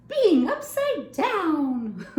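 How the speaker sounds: high, slow silly monster voice; drawn-out silly voice; silly monster read. Acted. Reading a silly monster's words.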